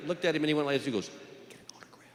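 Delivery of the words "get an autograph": barely audible whispered request; furtive whisper. Acted. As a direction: whisper it secretly across a courtroom. Bailiff's furtive request across courtroom.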